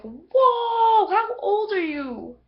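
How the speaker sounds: high, loud, half-crying shout of shock; shocked exclamation. Retold or imitated. Reacting to seeing old Katara, loud shocked cry. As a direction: yelp it out in disbelief. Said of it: "I'm like".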